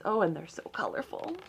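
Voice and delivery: fast, breathless whisper of wonder; whispered amazement at the colourful dragons; awed whisper. Acted. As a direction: hush as if the dragons might hear. Narrator awed by dragon page.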